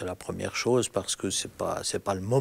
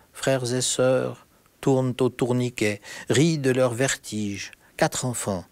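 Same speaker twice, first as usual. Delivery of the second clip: poem reading; 4 semitones higher, 5 dB louder than usual. Acted. Poet reads a poem aloud.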